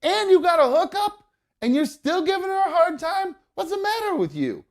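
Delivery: hammed-up high-pitched telling-off; mock indignant scolding. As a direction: play her as a shrill-edged scold telling off an old man. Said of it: "B one then interjects and goes".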